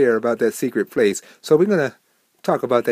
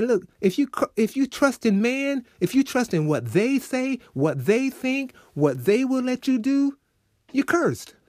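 raised, emphatic preaching warning; voicing God's warning; 10 semitones higher than usual. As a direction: speak for God, pressing each "you". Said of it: "He's saying".

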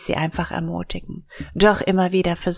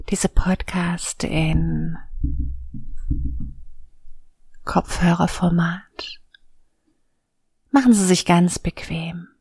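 soothing spa whisper. Acted. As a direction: guide them gently into calm. Leads a guided meditation.

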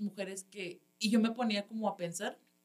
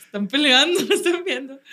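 laughing; 6 semitones higher, 11 dB louder than usual. Real, not acted. Laughing retelling.

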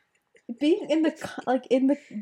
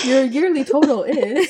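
laughing; 3 semitones higher, 4 dB louder than usual. Real, not acted. Joking bill through laughter.

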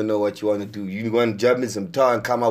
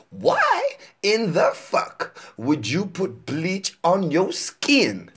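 very slow, higher, word by word; slower mocking repeat; 6 semitones higher, much slower than usual. Shown as a demonstration. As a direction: spell it out as if to a child. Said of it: "Let me repeat that for you".

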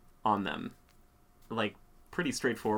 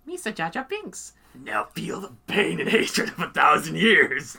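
much higher, slow, loud hammed-up villain Gungan; evil Jar Jar; 7 semitones higher, 6 dB louder, slower than usual. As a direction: ham up a menacing Jar Jar. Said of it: "evil Jar Jar".

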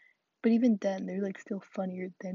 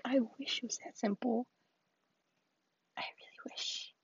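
whispered wish; 3 semitones higher, 4 dB quieter than usual. Retold or imitated. Retorting to people's easy advice.

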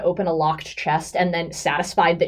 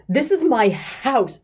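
rising panic; 5 semitones higher, slower than usual. Acted. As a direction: panic breaking through. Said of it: "kind of trembling".

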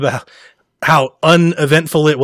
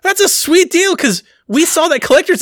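excited; 12 semitones higher than usual. Retold or imitated. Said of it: "I'm the only person that's like".

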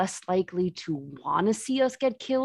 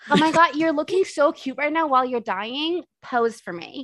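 put-on gushing valley girl; 6 semitones higher, 5 dB louder than usual. Valley girl gushing at dying friend.

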